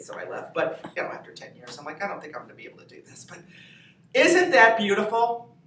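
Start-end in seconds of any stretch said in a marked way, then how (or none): 2.0-3.2 soft, fast, unvoiced resignation; defeated mutter. Comedian after ten years in LA, quietly giving up. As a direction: murmur it like you're giving up.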